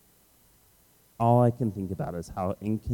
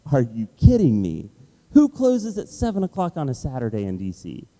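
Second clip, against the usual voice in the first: indignant outrage; 4 semitones higher, 6 dB louder than usual. Retold or imitated. Reacting to the café being closed.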